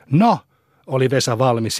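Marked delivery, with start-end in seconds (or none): 0.1-0.4 high, louder burst of readiness; ready and eager. A narrator's reading. Vesa takes up the cat challenge, eager. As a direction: jump at the dare on one word.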